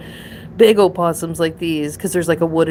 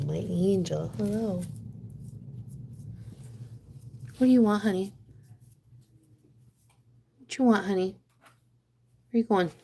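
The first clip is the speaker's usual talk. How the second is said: pet talk; 4 semitones higher, 6 dB quieter than usual. Real, not acted. Owner greeting her pet, soft cooing pet talk. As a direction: coo gently to the pet.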